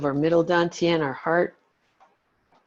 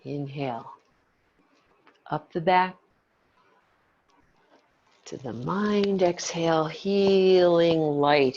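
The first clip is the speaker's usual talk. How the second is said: guided meditation. Acted. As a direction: lead the breath gently. Leads breathing exercise.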